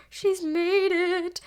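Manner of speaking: she's made it, dragged out with glee; drawn-out proud cheer. Real, not acted. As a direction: stretch it out like a proud parent.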